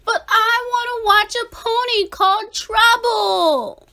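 put-on high, loud whine of a little girl; whiny little sister. A narrator's reading. Sister stamps her foot over the movie, high loud whine. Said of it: "stumped her foot and whined".

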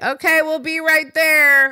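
high, loud, drawn-out holler from the back; calling out. Retold or imitated. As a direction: imitate staff calling through to the front. Staff calling out from back room.

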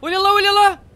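high, loud alarmed cry; alarmed shout. Real, not acted. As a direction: shout a sudden warning. Spots threat in game.

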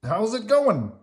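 high, loud, chummy question; bouncy puppet voice; Ernie. A narrator's reading. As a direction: ask a pal eagerly, voice rising. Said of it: "Ernie said".

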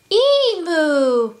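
high, loud, drawn-out animal call; replying platypus. A narrator's reading. Emu answering the kangaroo, high drawn-out reply. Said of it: "replied Emu".

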